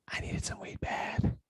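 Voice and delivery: a hushed, breathy confession of craving; whisper. Real, not acted. Telling viewers he craved weed, in a whisper. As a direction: whisper it like a guilty secret.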